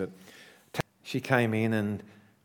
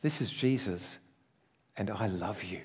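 voice of Jesus; 2 semitones lower, 4 dB quieter than usual. Retold or imitated. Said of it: "The voice said to me".

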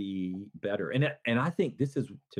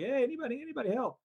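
imitated plea, pitched way up; mock cry for help; 14 semitones higher, faster than usual. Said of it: "he's screaming for help".

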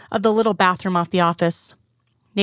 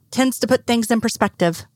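usual speaking voice

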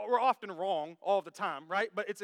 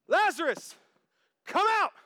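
high, loud shouted summons; Jesus calling out; 9 semitones higher, 9 dB louder than usual. Acted. Preacher re-enacts the reality of Jesus calling, shouting. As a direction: shout it as a command.

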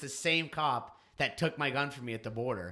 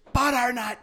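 much higher, much louder blurted yell; shouted Butternut; 12 semitones higher, 9 dB louder than usual. Retold or imitated. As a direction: bark it suddenly in his face. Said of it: "he'd scream it in my face".

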